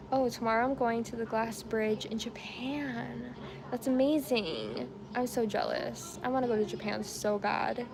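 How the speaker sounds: ordinary talk, nothing marked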